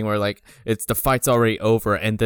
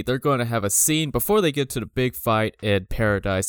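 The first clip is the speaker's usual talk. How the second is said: ordinary talk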